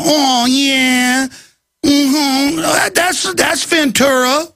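mock leering screener. Airport screeners ogling Ventura, mock lecherous coo. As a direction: ham up a leering guard checking out a celebrity.

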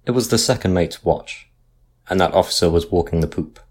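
ordinary delivery, nothing marked

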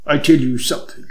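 a hint of the pronouncing sleuth; foreign detective. A narrator's reading. Hanaud's voice in dialogue.

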